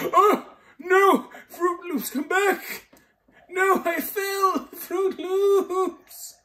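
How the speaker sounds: very high, loud panicked cries, slow at the fall; panicked wail. Real, not acted. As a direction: scream after the runaway pet as you drop. Falling after the pet in game.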